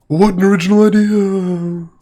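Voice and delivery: over-the-top sarcastic marvelling; sarcastic mock amazement. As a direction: gush in a high voice as if wildly impressed, meaning the opposite. Mocking the game's clichéd villains.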